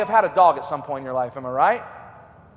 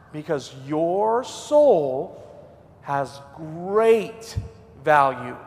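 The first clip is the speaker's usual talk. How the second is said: slow emphatic; 4 semitones lower, much slower than usual. Real, not acted. Sermon climax, slowed.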